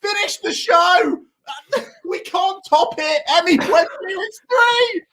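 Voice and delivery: ecstatic goal scream. Real, not acted. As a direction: lose it completely over the goal. Still screaming over third goal.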